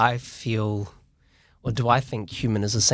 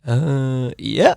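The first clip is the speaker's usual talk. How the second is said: hesitant drawn-out uh, then yeah rising high; unsure high-voiced yeah. Designer answering a client's question, voice climbing unsurely. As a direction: let the yeah slide up, unconvinced. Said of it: "when your voice goes two octaves higher".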